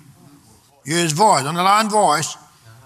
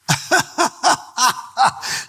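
long, higher-pitched staccato laugh; hearty laugh; 6 semitones higher than usual. Real, not acted. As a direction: let a long run of chuckles roll out. Preacher laughs mid-sermon.

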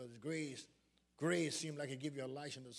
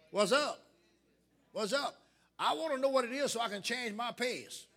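raised, insistent preacher's voice building in repeated phrases; rousing preaching; 4 semitones higher, 7 dB louder than usual. Real, not acted. Preacher challenging the church to change pace, rousing preaching. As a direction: stir the church up with driving emphasis.

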